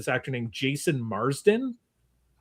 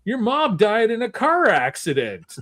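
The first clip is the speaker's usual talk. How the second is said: high-pitched mock voice of the film character, slowing down; mocking movie character; 8 semitones higher than usual. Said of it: "he's like".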